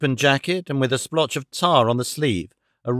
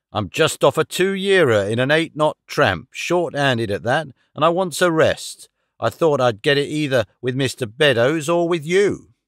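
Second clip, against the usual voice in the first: seaman's voice pleading his case, lively pitch; a weary sailor angling for a berth; old sailor. Acted.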